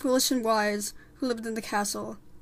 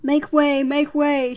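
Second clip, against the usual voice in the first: loud, raised crowd-clearing call; shouted; 4 semitones higher, 10 dB louder, slower than usual. Acted. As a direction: shout to open a path. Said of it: "shouted Frollo".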